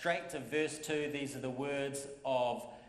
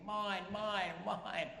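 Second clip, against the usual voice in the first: put-on demanding toddler whine, rising to a yell; toddler screaming; 5 semitones higher, much slower than usual. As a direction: play the grabby toddler. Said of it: "just screaming and screaming".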